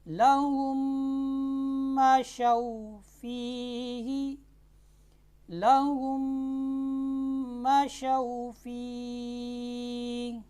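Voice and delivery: slow chanted tajweed recitation; Quran recitation. Acted. Teacher recites the verse for students, slow and chanted. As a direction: intone the verse slowly, melodically.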